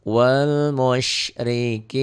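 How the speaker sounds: slow tajwid chant; Quran recitation. Shown as a demonstration. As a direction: draw the words out like a reciter. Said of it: "dihentikan jadi". (lantunan tartil pelan)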